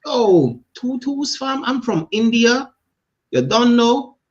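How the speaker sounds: high mock Jamaican slang voice; Jamaican accent. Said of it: "He gonna be like".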